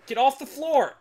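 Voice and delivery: loud, high yelled command; shouting. Real, not acted. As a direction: yell it at the screen. Yelling at game character.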